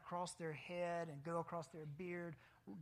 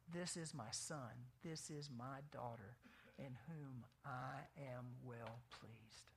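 slow, much softer Father's blessing; tender blessing; 5 semitones lower, 8 dB quieter, slower than usual. Acted. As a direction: speak the blessing gently, slowing right down.

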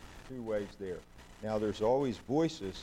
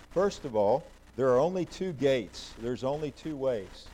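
loud, ringing declaration from the pulpit; emphatic preaching; 3 semitones higher, 7 dB louder than usual. Real, not acted. Preacher to congregation, a loud emphatic declaration. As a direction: proclaim it to the whole room. Preacher drives home main point.

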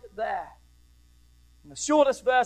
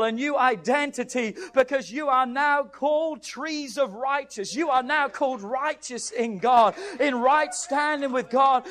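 raised pitch, driving sermon delivery; forceful preaching; 6 semitones higher than usual. Acted. Preacher proclaiming Isaiah's prophecy to the church, forceful preaching. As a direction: preach it with sustained intensity.

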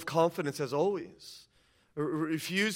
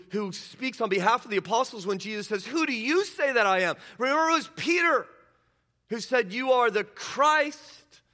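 raised, loud preaching voice, pressing each point; preaching at full force; 7 semitones higher, 6 dB louder than usual. Acted. Pastor rousing the congregation about Peter, in full-force preaching. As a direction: drive it home from the pulpit, higher and louder.

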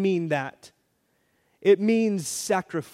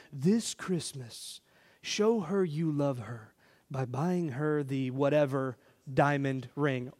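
low, soft commercial-announcer read; lightly voiced TV ad announcer; mock ad voice; 5 semitones lower, 6 dB quieter than usual. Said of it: "the commercial said".

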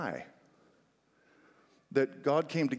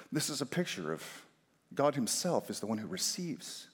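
ordinary talk, nothing marked

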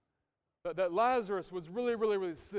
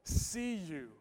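"I see you" slow and louder; slow and tender; 6 dB louder, much slower than usual. Shown as a demonstration. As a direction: lean into "I see you" slowly. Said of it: "Empathy says".

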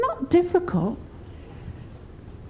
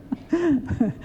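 soft chuckle; 3 dB quieter than usual. Real, not acted. Closing laugh.

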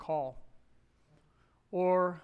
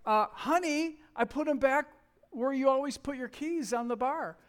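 lightly voiced wife, higher and patient; a hint of his wife, a touch higher; wife patiently answering about his keys; mock wife; 6 semitones higher, 4 dB louder than usual. Wife's voice retold in quarrel.

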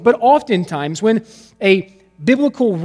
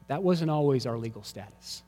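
normal talking voice

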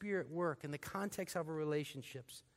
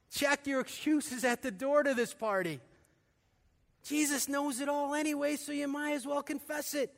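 higher and louder, preaching at full force; fiery preaching; 7 semitones higher, 5 dB louder than usual. Acted. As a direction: drive the gospel home from the pulpit.

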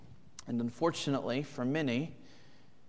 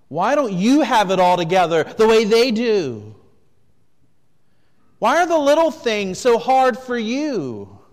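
louder and higher, accusing; conscience screaming; 8 semitones higher, 12 dB louder than usual. Acted. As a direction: shout it like your own mind attacking you. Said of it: "your conscience begins to scream at you".

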